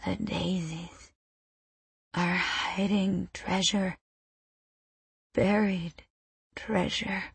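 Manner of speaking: very soft, slow, halting murmur; faint, slurry words with long gaps; drunken mumble. A narrator's reading. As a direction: mumble it as you drift out of consciousness. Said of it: "she mumbles something with a drunken stammer".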